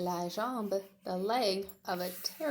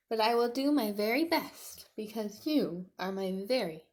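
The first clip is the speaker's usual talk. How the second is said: louder, earnest small T-Rex; Tiny comforting; 2 semitones higher, 7 dB louder than usual. Acted. Tiny comforting Pointy, earnest. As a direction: reassure a hurt friend.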